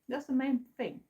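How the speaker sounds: words around a sip of a drink; talking while drinking a shake; sipping. Real, not acted. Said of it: "I'm sorry if I'm drinking".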